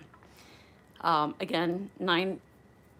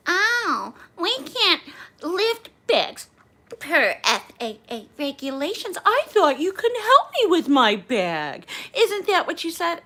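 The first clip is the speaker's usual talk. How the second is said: high, loud, drawn-out mimicry of a passenger; exaggerated mocking whine, slow and high; 10 semitones higher, 8 dB louder, slower than usual. Passenger mocks the flight attendant over lifting bags, mocking. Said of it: "she mocks me".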